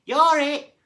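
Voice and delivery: very high, very loud howl; a haddock howling the tag call. A narrator's reading. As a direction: howl it as loud as you can. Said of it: "howled a haddock".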